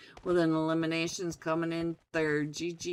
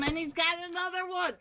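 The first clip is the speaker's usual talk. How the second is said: rising hype; 11 semitones higher, slower than usual. Real, not acted. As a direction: pitch climbs into a high excited scream. Caster calls triple elimination.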